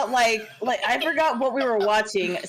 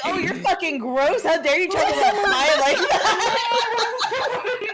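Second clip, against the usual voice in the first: higher-pitched, laughing mock indignation; playfully appalled, drawn out at a higher pitch; mock outrage; 8 semitones higher, slower than usual. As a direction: act scandalized while fighting back laughter. Retelling her offended reply to partner.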